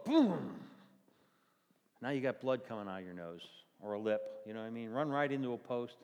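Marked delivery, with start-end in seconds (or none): 0.0-0.7 mock crash sound. Hitting a post.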